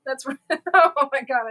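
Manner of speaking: loud burst of laughter through the words; laughing. Real, not acted. Cracking up at a viewer's comment, loud laughing. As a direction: laugh out loud, then blurt it. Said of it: "I snorted".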